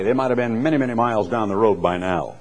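usual speaking voice

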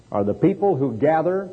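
ordinary talk

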